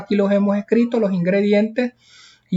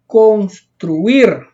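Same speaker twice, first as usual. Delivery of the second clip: construir with its final syllable punched louder; stress on last syllable; 4 dB louder than usual. Shown as a demonstration. Teacher showing pupils where construir carries its force, stressed last syllable. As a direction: hit the -IR of construir, drawn out. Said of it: "Lleva la mayor fuerza de voz".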